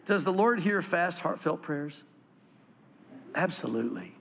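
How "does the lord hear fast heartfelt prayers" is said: usual speaking voice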